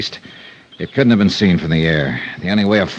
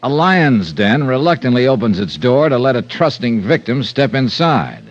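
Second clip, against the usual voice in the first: raised, bright teaser for the next episode; radio promo read; 4 semitones higher than usual. Acted. Star teasing tomorrow's episode to radio listeners, promo read. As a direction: sell tomorrow's show to the listeners.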